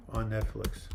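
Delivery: normal talking voice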